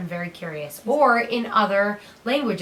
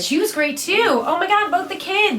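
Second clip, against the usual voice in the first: much higher and louder, thrilled; gushing excitement; 8 semitones higher, 6 dB louder than usual. Real, not acted. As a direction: fan squealing over the movie. Gushing about the movie's kids.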